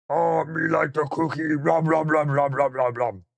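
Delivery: high, loud monster munching cookies. Acted. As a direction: gobble like a furry monster.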